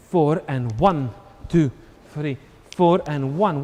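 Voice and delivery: counting the beat aloud. Shown as a demonstration. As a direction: stress on one, slow count. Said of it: "So it's one".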